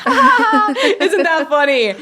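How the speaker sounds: high, loud laughing exclamation. Real, not acted. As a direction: say it through a big incredulous laugh, drawn out. Bitter laugh at unequal apologies.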